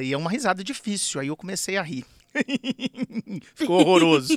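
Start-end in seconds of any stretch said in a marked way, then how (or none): 2.3-3.5 a failed hoarse laugh that comes out like a clown; hoarse laugh attempt. Shown as a demonstration. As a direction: try a raspy comic laugh and miss. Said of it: "tentei fazer essa rouquidão na risada". (risada rouca malfeita, parecendo palhaço)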